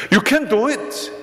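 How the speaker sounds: high, driving encouragement; coach's shout. Retold or imitated. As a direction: cheer the player past his limit. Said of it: "계속 소리를 치면서".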